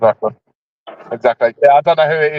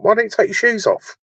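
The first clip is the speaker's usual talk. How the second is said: deadpan wind-up; 10 semitones higher than usual. Retold or imitated. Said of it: "I went".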